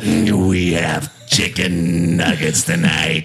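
very low, slow voice into a fan; deep, slow Vader-style announcement; Darth Vader voice. Pretend. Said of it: "He thinks he sounds like Darth Vader".

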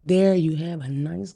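usual speaking voice

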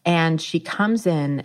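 ordinary talk

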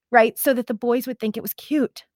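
usual speaking voice

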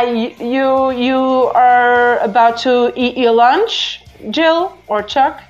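normal talking voice

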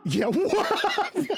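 high, slow, laughing mock voice; playful high echo. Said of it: "echoing the line".

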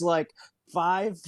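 normal talking voice

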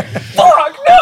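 high laughing yell of despair; mock despairing cry. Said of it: "you're just gonna hear".

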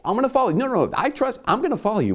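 high, hurried mock-eager pleading; eager anxious sheep. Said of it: "I'm like".

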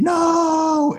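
high shrieked mock NO; mock scream. Two neighbors screaming at the discovery, hammed-up. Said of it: "they both simultaneously scream".

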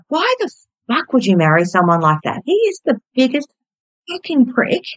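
put-on high-pitched, heated replay of the teenage rant; retold furious outburst. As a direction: relive the rage without the volume. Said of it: "I was ropeable".

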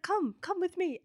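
imitating a Greek host calling guests to the kitchen; mock Greek waiter. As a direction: wave them over in a light Greek accent. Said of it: "He's like".

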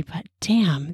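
ordinary delivery, nothing marked

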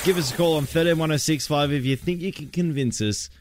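normal talking voice

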